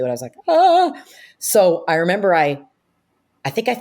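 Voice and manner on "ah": high, loud re-enacted squeal; excited scream. New hire reacting to friends' prediction, high mock scream.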